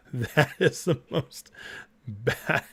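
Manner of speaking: words broken up by real laughter; cracking up mid-sentence; laughing. Real, not acted. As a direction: laugh through it, barely getting the words out. Laughing at the Trump caption.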